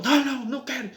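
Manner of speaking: very high-pitched, worked-up policewoman; mimicked agitated refusal. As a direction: fire off a mock refusal in a high voice. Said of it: "já saiu nervosa".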